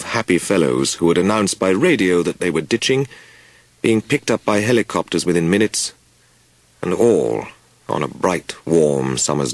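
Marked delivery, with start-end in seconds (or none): none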